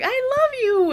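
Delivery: very high, tender coo; tender love. Retold or imitated. Telling her soul she loves her, very high coo. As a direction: coo it lovingly.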